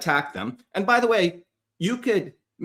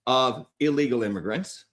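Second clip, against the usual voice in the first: ordinary talk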